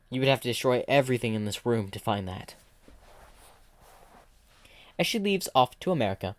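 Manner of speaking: ordinary delivery, nothing marked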